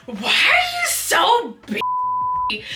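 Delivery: put-on blackout drunk. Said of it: "she's slurring her words".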